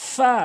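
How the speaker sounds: "ফা" with a long hissing f; correct pronunciation. Shown as a demonstration. Teacher models the Arabic letter, true f "ফা". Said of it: "সঠিক উচ্চারণ".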